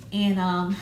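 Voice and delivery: ordinary talk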